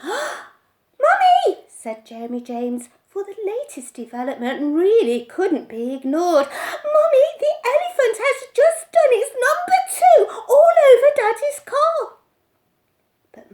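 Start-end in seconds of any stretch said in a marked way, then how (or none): 0.0-1.6 very high, very loud; boy calling his mother, very high and loud; excited child call. A narrator's reading. 6.5-12.2 put-on very high, loud, breathless laughing boy; boy shouting news to mother, very high and loud; excited shout. A narrator's reading.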